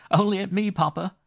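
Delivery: high, loud, laughing tease; arch. A narrator's reading. Daughter archly answering her father, loud mischievous tease. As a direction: tease Papa with a sly glint. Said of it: "answered his daughter archly".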